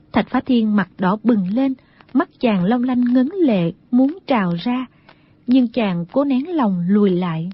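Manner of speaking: usual speaking voice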